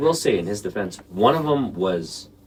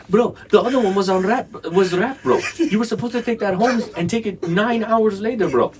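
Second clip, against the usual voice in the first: louder, higher exasperated scolding; 13 semitones higher, 5 dB louder than usual. Retold or imitated. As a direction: tell him off, exasperated and high. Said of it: "He was like".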